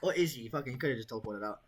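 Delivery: ordinary delivery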